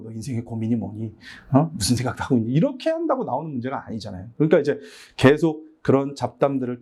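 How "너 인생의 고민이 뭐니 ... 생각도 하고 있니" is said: low, grave counselling tone; mock solemn. Teacher sitting a student down about life worries, solemn. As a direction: imitate an earnest, weighty sit-down question. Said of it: "진중하게 앉아가지고".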